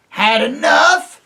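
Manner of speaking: very high, loud slow taunt; mock the beaten enemy; jeering yell. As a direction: dwarfs jeering at the retreating soldiers. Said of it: "they yelled".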